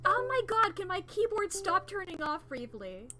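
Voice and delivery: high, loud fed-up cry; exasperated shout. Real, not acted. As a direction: yell at the keyboard. Keyboard keeps turning off.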